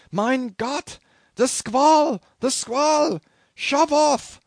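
loud, high-pitched howled orders; skipper's howl. A narrator's reading. Skipper sees the squall and orders the boat off, howling. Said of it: "Suddenly the skipper howled".

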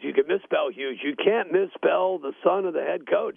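ordinary delivery

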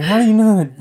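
loud, high-pitched mock outrage; mock exasperation. Retelling a reaction to partner's text, mock exasperation. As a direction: blurt it out high and loud in disbelief.

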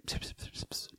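hushed psst sounds, no voice; very soft whispered hissing; imitated whispering. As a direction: mimic furtive whispers. Imitates men whispering as they enter.

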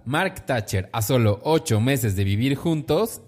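high, loud tabloid announcer; gossip headline read. Acted. Host announces a cancelled wedding to co-host, punchy headline voice. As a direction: belt out the scandal headline.

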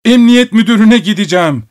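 high, loud, enraged yell; furious shout. A narrator's reading. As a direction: scream the threat at the servant. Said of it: "gittikçe şiddetlenen bir sesle bağırıyordu". (çok yüksek sesle öfkeli haykırış)

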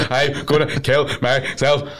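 slow, broken words between sobs; hammed-up getting breath back after bawling; mock crying recovery. Shown as a demonstration. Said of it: "It's a recovery period".

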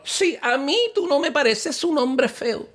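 high, slow, loving tease; sweet girlfriend voice. Acted. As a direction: coo it sweetly, then crack up. Girlfriend voice, laughing. (novia dulce que se ríe al final)

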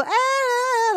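high, loud drawn-out wail; hammed-up background wailing; mock crying wail. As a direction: imitate an overwrought crowd crying. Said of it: "they always sound like they're crying".

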